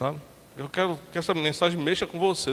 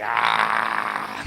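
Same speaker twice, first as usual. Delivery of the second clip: very low, rough growled Ah; demonic growl. Retold or imitated. Preacher imitating the possessed man's growl at the rehearsal. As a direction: growl it out from deep in the chest. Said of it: "O cara fez".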